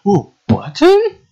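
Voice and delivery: high, drawn-out mock wonder; delighted discovery. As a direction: coo high over a small find. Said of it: "I was like".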